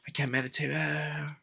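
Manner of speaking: held-back mock yell of frustration, kept soft; strained, hushed imitation of a fed-up monk. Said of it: "he starts yelling".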